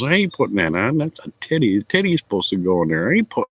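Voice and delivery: hammed-up grumpy dad refusing the gift; lightly voiced indignant father; imitating old father. Father refusing bra face mask.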